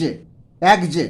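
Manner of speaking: एग्ज ending in a voiced "gj"; final j sound. Shown as a demonstration. Teacher gives the exact reading, एग्ज with s turned to j. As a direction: say एग्ज as "egg-j", not "eks". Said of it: "इसको पढ़ेंगे हम".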